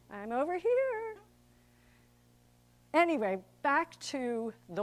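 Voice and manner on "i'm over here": attention-seeking bit player. Acted. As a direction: pipe up high from the back row. Bit-part actor clamouring for notice.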